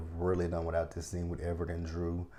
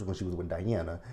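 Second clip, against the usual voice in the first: ordinary delivery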